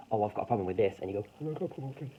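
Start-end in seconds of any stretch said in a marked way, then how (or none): 1.4-2.1 high-pitched mocking parrot voice; mocking imitation. Arguer mocking how the opponent speaks, high mocking voice. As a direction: mimic them mockingly, pitched right up. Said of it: "you mock the way they speak".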